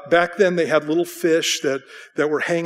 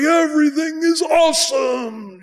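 much higher, slow, shouted wonder; child bursting with delight; kid's excitement; 12 semitones higher, 5 dB louder, slower than usual. Retold or imitated. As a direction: shout it like a thrilled kid. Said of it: "You go with a kid, it's like".

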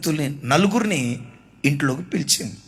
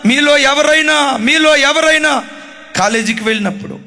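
fiery preaching; 5 semitones higher than usual. Acted. Preacher warning the listening young people, fervent. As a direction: exhort them, rising in force.

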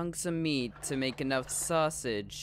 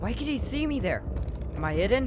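loud, high panicked yelling; panicked shout; 7 semitones higher, 5 dB louder than usual. Real, not acted. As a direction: shout it out in alarm. Spotted by the monster.

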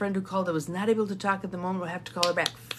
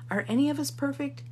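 ordinary talk

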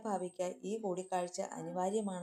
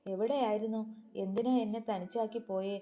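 plain reading voice

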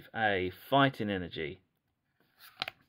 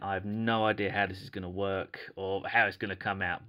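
ordinary talk

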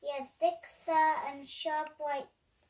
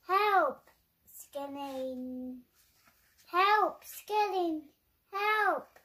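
shouting for help; 4 dB louder, much slower than usual. Acted. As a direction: call out desperately from the tree. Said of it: "he shouted".

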